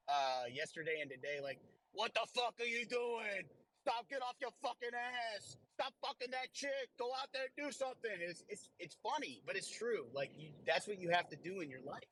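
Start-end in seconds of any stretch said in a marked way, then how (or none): 1.9-8.1 rough, higher yelling comic rant; Joey Diaz. Retold or imitated. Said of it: "Joey Diaz did an Instagram reel".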